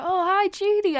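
high, loud chirpy hello; bright greeting. Retold or imitated. Friend walking up at a party, gushing hello.

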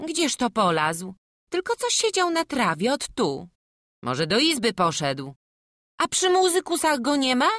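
loud, high-pitched villager; bossy village woman. Acted. Village woman sends others looking for the child, loud calling voice. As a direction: take charge and call out across the yard.

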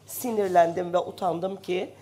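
usual speaking voice

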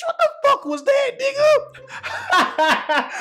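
high, fast cry of disbelief breaking into a laugh; shocked yell. Real, not acted. Watching the fighter's windmill, high shocked cry. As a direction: shriek it in disbelief. Reacting to a wild swing.

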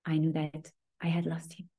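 whispered, barely voiced; a quiet whisper of loss; hushed confession. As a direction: breathe it out like a secret grief. [name] confiding her loss.

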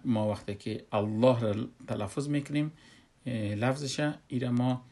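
normal talking voice

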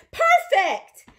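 loud, high exclamation; excited praise. Real, not acted. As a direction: burst out with delighted approval. Praises the successful greeting.

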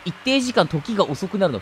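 ordinary delivery

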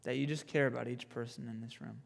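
soft, low, hushed praying; prayer. Real, not acted. Youth pastor prays for everyone in this room, soft prayer. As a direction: pray gently and sincerely, softly.

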